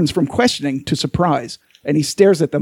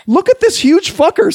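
much higher, eager show-off; excited priest; 13 semitones higher, 3 dB louder than usual. Acted. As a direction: show it off gleefully.

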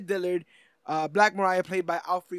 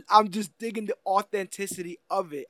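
ordinary talk

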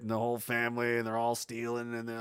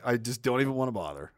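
normal talking voice

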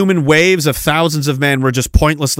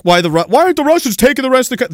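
higher, fast mocking question; mocking whiny critics; 10 semitones higher, faster than usual. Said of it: "And they're like".

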